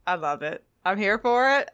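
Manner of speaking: ordinary delivery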